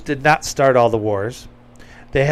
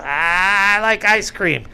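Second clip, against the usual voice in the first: slow, higher dopey caricature; mock Joe Biden; 6 semitones higher, much slower than usual. Mocking the president's answer, slow and higher. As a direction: drag it out like a confused old man. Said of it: "Tell him no, Joe".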